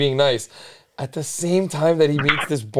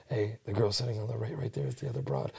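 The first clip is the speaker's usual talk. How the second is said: very soft, hushed tip-off; hushed aside; 5 semitones lower, 17 dB quieter than usual. Retold or imitated. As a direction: tell him quietly so the girls won't hear. Pointing out girl secretly.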